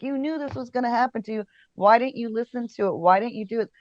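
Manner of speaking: mock telling-off aimed at yourself; self-scolding. Scolding herself in retold self-talk.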